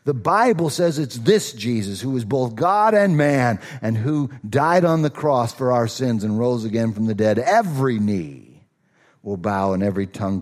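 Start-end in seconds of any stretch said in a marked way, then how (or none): none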